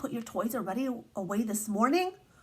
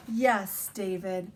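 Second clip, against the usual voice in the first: ordinary delivery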